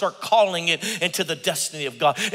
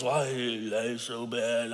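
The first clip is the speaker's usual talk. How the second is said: mopey lament; 5 semitones lower, 7 dB quieter, much slower than usual. Retold or imitated. Sick father-in-law complaining.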